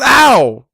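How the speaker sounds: sharp high loud yelp; pained yelp. A narrator's reading. Colt yelping when his girlfriend hits him. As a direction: yelp at the cuff. Cuffed on shoulder.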